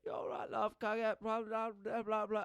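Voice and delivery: high-pitched mock-Cockney lady; Cockney accent impression. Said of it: "it's like the Cockney".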